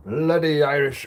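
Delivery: put-on loud, high Irish accent, drawn out; Irish accent impression. Streamer swearing in Irish on live stream, hammed-up Irish accent. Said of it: "best Irish impression I've done so far".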